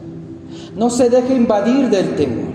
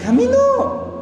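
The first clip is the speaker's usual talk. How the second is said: triumphant exclaim; slower than usual. Acted. Triumphant answer about Elijah.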